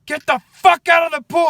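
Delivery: put-on guard imagined roaring at kids in the pool, furious shout; imagined furious yell. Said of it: "he's like".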